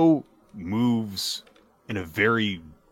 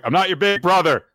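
higher, louder retold protest; mock indignant yell; 10 semitones higher, 6 dB louder than usual. Man rebuffing a little girl, mock indignant yell. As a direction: push it out as an exaggerated denial. Man rejects girl's name for him.

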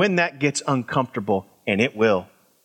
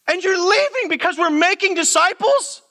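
much higher, building incredulity, voice climbing; stunned disbelief; 12 semitones higher than usual. Retold or imitated. Pastor repeating the leader's reason back to him: stunned disbelief, high and climbing. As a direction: play it as utter bafflement.

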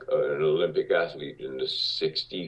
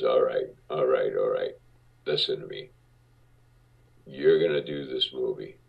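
much lower, gruff producer voice; Marty Bregman's deep voice; 5 semitones lower than usual. Retold or imitated. Producer sizing up a young actor, lower deep voice. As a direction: drop low and heavy like a big-shot producer. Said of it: "he had a deep voice like this".

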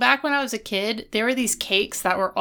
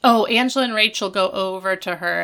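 usual speaking voice

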